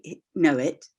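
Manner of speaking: ordinary delivery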